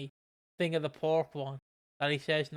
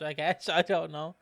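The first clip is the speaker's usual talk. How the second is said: normal talking voice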